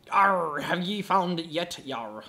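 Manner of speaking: slow hammy pirate growl. Pretend. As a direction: arr it like a pirate. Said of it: "stop doing that pirate voice".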